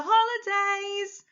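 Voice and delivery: high, sustained cheer; excited. Shown as a demonstration. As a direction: sing out the news with excitement. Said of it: "they're surprised or they're excited".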